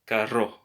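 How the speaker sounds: carro with a strong trilled rr; raspy rolled R. Shown as a demonstration. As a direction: roll the rr in Carro, raspy and long. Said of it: "it's raspier than if you would have only one R".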